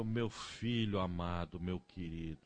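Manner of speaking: soft, slow, loving mother voice; tender mother. Retold or imitated. Preacher voicing his mother praising him, soft and slow. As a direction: coo lovingly to the son.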